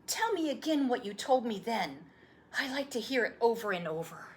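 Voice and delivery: put-on an eager young boy begging for the story; boy's voice. Boy pleads.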